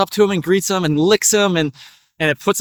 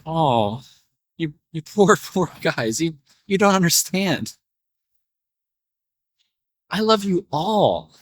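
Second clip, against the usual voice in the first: tender reassurance. Acted. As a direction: soothe the worried animals gently. Farmer gently reassures his worried animals.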